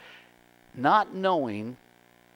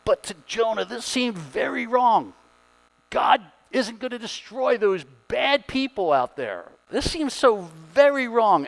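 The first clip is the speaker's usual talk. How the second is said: "this seemed very wrong" read higher and louder, with outrage; indignant dramatic reading; 8 semitones higher, 5 dB louder than usual. Shown as a demonstration. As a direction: act out Jonah's indignation, rising higher. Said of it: "I can't even say that demonstrative enough".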